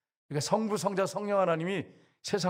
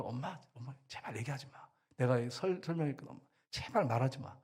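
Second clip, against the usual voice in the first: soft imitated plea to mom; hushed retelling of a son's plea; quiet pleading; 2 semitones lower, 6 dB quieter than usual. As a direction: beg mother under your voice to stop talking. Son pleading with his mother.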